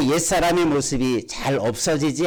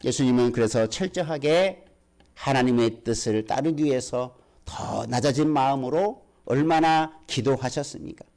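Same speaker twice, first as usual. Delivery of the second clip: usual speaking voice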